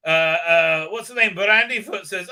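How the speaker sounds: ordinary delivery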